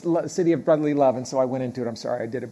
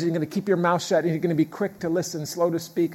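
normal talking voice

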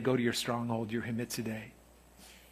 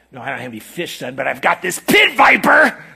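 mock-innocent father offering a snake; sarcastic father shouting the punchline; sarcastic dad; 13 dB louder than usual. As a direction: play the joke dad deadpan then punch it. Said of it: "he's being sarcastic here".

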